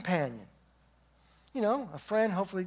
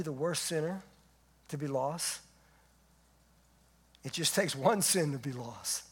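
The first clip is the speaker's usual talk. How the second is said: closing prayer; 4 semitones lower than usual. Acted.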